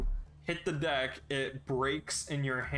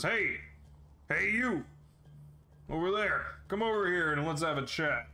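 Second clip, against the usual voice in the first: guard calling out; 4 semitones higher than usual. Acted.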